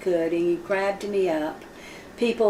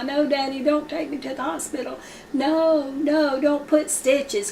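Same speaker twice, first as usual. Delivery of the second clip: put-on higher pleading child's voice; frightened boy begging father, pitched up; pleading child crying; 6 semitones higher than usual. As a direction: beg tearfully like a scared little boy. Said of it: "I began to cry".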